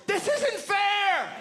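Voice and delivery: high, slow, indignant protest cry; shouted slogan. Retold or imitated. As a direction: yell it like a lone protester rallying the crowd. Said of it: "my slogan was".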